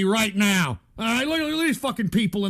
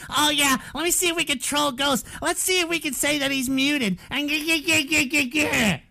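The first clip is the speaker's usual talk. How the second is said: higher put-on voice imitating trolls; mocking troll voice; 5 semitones higher than usual. Streamer imitating chat plotting to troll Ghost, mocking. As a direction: mimic a scheming troll, ending in a stuttered cackle. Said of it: "You fucking people are putting".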